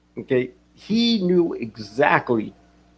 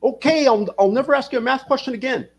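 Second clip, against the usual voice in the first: mock startled backing off; 10 semitones higher than usual. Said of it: "I was like".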